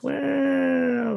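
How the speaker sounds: long, high-pitched wailing "well"; mock dismay. User reacting to the upgrade demand, exaggerated dismay. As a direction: stretch it out high and loud. His mocking reaction to the ultimatum.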